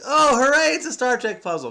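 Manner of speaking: hammed-up hooray; mock delight. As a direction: gush with put-on glee. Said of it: "but I can't be like".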